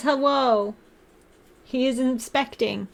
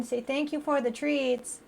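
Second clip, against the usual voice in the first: usual speaking voice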